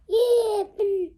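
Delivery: eager loud high yelp; excited burst. Acted. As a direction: blurt it out to sell the idea. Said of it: "waving his arms and legs around to make it sound better".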